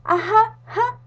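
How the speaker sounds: a lazy king's "ja"; king's mock laugh. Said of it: "dijo el rey".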